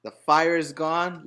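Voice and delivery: ordinary delivery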